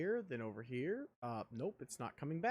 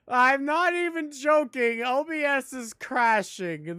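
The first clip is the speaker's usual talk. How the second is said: laughing disbelief; 7 semitones higher, 11 dB louder than usual. Real, not acted. OBS crashes mid stream.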